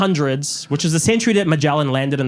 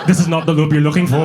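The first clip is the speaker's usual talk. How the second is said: lightly voiced Jedi mind trick; 4 dB louder than usual. Mom's dodge done as a mock Jedi mind trick. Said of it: "tried to Jedi mind trick me".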